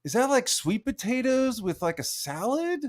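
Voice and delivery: high, sugary fake-nice voice; mock nice. Mocking a streamer's art in a fake-nice voice. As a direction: put on a sweet, high polite act. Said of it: "being nice".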